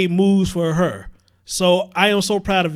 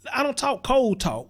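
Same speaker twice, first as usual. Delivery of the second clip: usual speaking voice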